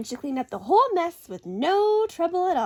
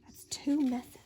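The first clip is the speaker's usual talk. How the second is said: whispered aside; 3 dB quieter, slower than usual. Acted. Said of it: "aside to listener, whispered".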